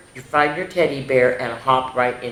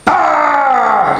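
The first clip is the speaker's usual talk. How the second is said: high, loud flexing shout; strongman yell; 12 semitones higher, 8 dB louder than usual. Shown as a demonstration. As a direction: belt out a triumphant grunt. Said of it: "make a muscle".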